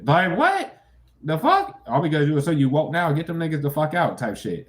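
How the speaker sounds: high, loud exasperated outburst; outraged. Real, not acted. Streamer raging at trolls, loud and high.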